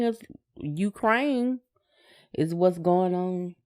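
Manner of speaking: ordinary talk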